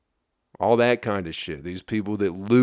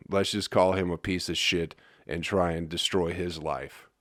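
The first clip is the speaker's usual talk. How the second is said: ordinary talk, nothing marked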